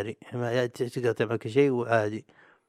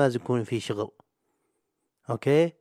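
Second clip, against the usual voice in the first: usual speaking voice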